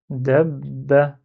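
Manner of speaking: DAB BA with the b held before ba; doubled b. Shown as a demonstration. Teacher repeating the word with shadda, lengthened b. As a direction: close on the b, wait, release "ba". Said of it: "je m'attarde sur le son b avant de faire ba".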